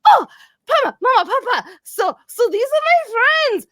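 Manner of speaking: high, excited, stumbling eagerness; eager introduction. Acted. Grendel presenting her friends to her parents, excited. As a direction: tumble into introductions with bubbling excitement. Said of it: "she goes".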